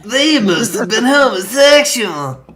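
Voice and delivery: high, hammed-up scholarly verdict; exaggerated historian imitation; mock historian. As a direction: pitch it way up as a mock pronouncement.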